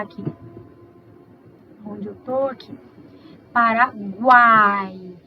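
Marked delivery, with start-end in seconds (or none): 3.5-5.2 Paraguai very slowly, every sound drawn out; slow demonstration. Shown as a demonstration. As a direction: stretch Paraguai so each sound is heard. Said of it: "Olha aqui que nós temos".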